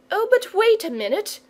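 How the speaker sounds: put-on high-pitched little-girl protest; eager cry. A narrator's reading. Charlotte to departing storyteller, eager cry. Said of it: "cried Charlotte".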